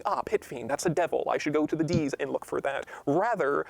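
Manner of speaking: ordinary talk, nothing marked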